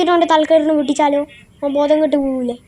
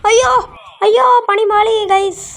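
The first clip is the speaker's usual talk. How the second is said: startled oh no; 6 semitones higher than usual. Real, not acted. Gamer yelps at his viewers as the lift fails, startled. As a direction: gasp as the plan falls apart.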